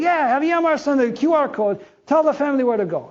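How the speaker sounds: high eager valet. Retold or imitated. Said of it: "He goes".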